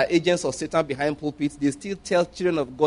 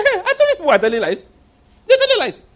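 much higher, louder, faster "I told you!"; triumphant shout; 6 dB louder, faster than usual. Retold or imitated. Preacher to congregation, gloating that he was right, shouting. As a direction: crow it out, vindicated. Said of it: "I said".

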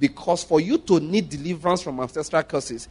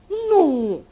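sharp high "No"; 11 semitones higher than usual. Real, not acted. Preaching denial.

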